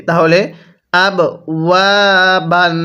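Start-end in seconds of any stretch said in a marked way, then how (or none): 1.1-2.7 "ওয়া" held long between short "আব" and "বান"; one-alif stretch. Shown as a demonstration. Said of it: "এক আলিফ টানতে হবে".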